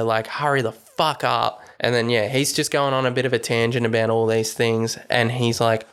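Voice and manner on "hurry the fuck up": ordinary talk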